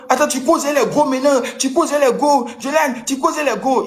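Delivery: beaucoup plus aigu, finit en cri lent; réplique criée. Retold or imitated. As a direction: lance la réplique en haussant le ton, plus haut. Dispute retranscrite à l'hôtel. (il lui reproche de parler aux filles, ton très aigu crié)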